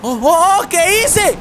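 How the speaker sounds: shocked cry. Real, not acted. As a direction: caught off guard by his own crash. Sudden crash in the race.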